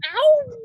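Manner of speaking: loud high yelp; a sudden pained "ow"; startled yelp; sharp cry of pain. Acted. Startled cry opens scene.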